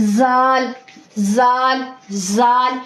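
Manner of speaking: जाल with a firm buzzing ज़; firm zaal. Shown as a demonstration. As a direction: press the ज़ of जाल hard. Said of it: "इसको हम थोड़ा सख्ती से पढ़ेंगे". (जाल सख्ती से)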